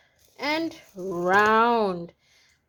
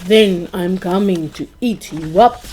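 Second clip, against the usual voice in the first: loud roaring troll threat; 3 semitones lower, 8 dB louder than usual. A narrator's reading. Troll roaring at the goat from under the bridge, loud. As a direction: roar it out like a hungry troll. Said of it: "roared the troll".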